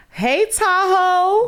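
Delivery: high, slow, drawn-out rising greeting; a delighted high call of the name; bright excited hey. Shown as a demonstration. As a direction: sing it out high and stretched. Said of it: "Three different energies".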